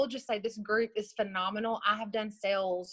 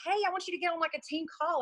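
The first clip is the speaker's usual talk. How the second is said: higher-pitched quoted request; retold breezy message; 8 semitones higher, faster than usual.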